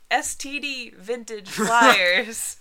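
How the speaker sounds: slow, loud, drawn-out announcer read. Acted. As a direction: boom it out like a grand title. Announcing the topic title.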